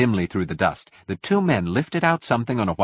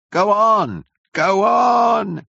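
high, loud impatient yells; raised, drawn-out yell; angry shouting; 13 semitones higher, 6 dB louder, much slower than usual. A narrator's reading. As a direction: shout angrily from the back of a crowd. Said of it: "shouted angry voices behind".

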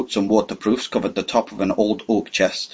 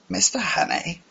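put-on higher, softer and hesitant old man; 11 semitones higher, 5 dB quieter than usual. A narrator's reading. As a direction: ask haltingly, unsure. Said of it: "he said hesitatingly".

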